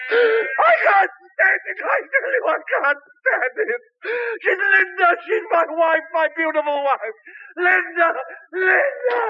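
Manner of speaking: husband wailing for his wife; anguished crying. Acted. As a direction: break down crying out.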